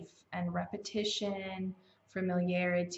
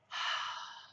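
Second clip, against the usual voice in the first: long, breathy exhale; unhurried sigh to centre yourself; calming sigh; 4 dB quieter than usual. Shown as a demonstration. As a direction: let out a settling breath. Demonstrates connecting with breath.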